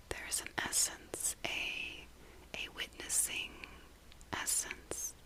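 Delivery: fully whispered, phrase by phrase; whispered meditation. Real, not acted. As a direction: confide it in an unvoiced whisper, pausing between thoughts.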